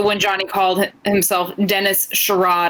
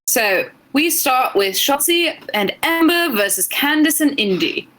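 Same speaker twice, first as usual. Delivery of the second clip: slow, careful English accent; British accent; 4 semitones higher than usual. Shown as a demonstration. Co-host recapping a match on a dare, British accent. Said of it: "give us your English accent".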